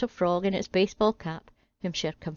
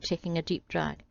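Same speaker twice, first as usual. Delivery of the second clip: plain reading voice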